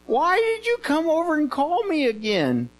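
high, whining complaint; acted exasperation. Eli woken again by Samuel, play-acted exasperation. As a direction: demand an answer in high-pitched mock annoyance.

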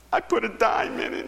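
high-pitched mock crying; tearful man. Crying man confesses small offering to pastor, high tearful imitation. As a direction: imitate a distressed man's wail. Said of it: "he was just a-crying".

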